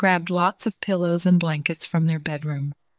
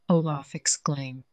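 ordinary delivery, nothing marked

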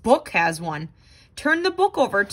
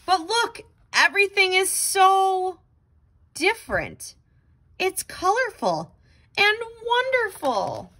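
higher, marveling; amazed wonder; 8 semitones higher than usual. Acted. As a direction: gasp at the new colors. Amazed at the colorful world.

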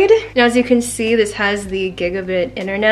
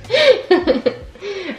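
high embarrassed giggle; 9 semitones higher than usual. Real, not acted. Awkward filming moment.

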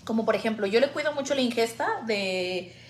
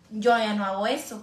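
ordinary talk, nothing marked

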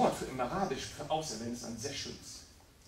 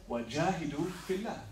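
Koranrezitation; much faster than usual. Acted. Koranvers mitten in der Predigt.

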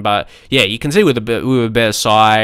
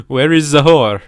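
put-on joking go at a European accent; mock European accent between laughs; vague European accent. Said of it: "what kind of accent I'm trying to do".

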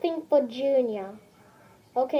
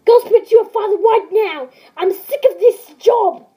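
loud, furious scolding, dragging out the last words; angry shout; 7 semitones higher, 13 dB louder than usual. Acted. Mother yelling at Junior to go to his father, angry shout. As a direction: yell him out of the room, fed up.

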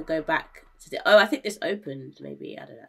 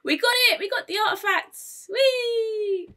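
triumphant; 4 dB louder than usual. Real, not acted. Success in game.

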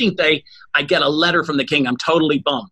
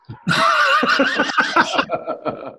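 a burst of high laughter; cracking up at the punchline. Real, not acted. Laughing at own Arnold Palmer story.

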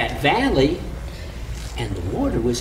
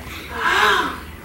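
sharp gasp; 14 semitones higher than usual. Acted. Sharp gasp, big rise.

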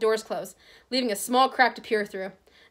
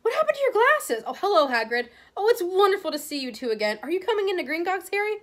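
put-on high-pitched, excited girl; eager schoolgirl; 9 semitones higher than usual. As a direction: greet friends in a high, eager rush.

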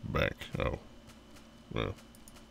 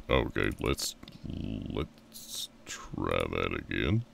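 ordinary talk, nothing marked

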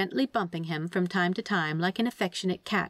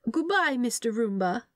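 fond goodbye; 5 semitones higher than usual. A narrator's reading. Angel waving goodbye to Roomba.

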